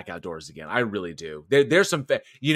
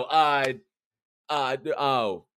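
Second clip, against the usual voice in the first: usual speaking voice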